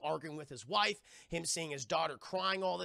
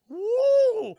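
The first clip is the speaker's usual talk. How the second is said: long, high, loud "woo"; imitated wail of a glowing figure; eerie wail; 14 dB louder than usual. Electricity man stares at him, high wail.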